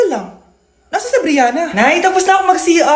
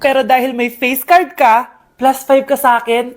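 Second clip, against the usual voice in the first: normal talking voice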